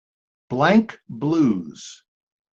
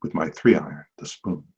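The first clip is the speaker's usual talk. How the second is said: hushed, much lower golf broadcast murmur; golf commentator; 11 semitones lower, 5 dB quieter than usual. Acted. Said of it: "That's my golf voice".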